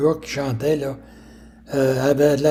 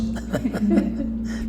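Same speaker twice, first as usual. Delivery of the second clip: airy laugh; 7 semitones higher, 6 dB quieter than usual. Real, not acted. Laughs after his punchline.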